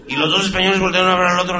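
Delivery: ordinary talk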